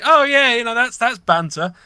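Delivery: high, loud mock brush-off; mocking dismissive tone. Mocking a bloke who calls groping banter, high and loud. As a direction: scoff as if it were nothing.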